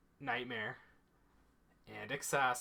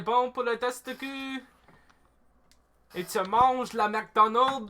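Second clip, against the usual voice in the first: mock French; 8 semitones higher, 9 dB louder than usual. Teasing a listener with McDonald's, in loud mock French. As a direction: ham up the French, higher and louder. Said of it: "I spoke a little bit of French".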